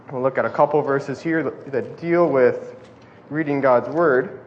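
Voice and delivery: normal talking voice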